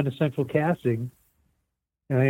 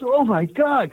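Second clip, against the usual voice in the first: surprised; 10 semitones higher, much slower than usual. Retold or imitated. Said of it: "he says".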